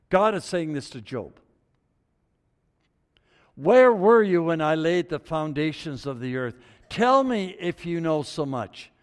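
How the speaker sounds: loud, ringing scripture reading; preaching at full force. Acted. Preacher thundering God's words to Job. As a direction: boom it out like the voice of God.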